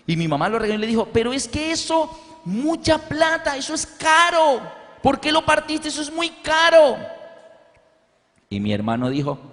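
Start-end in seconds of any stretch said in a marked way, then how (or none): 1.1-6.9 high, shrill-pitched scolding imitation; scolding mother. Mother scolding her son over the expensive device, high mock scolding.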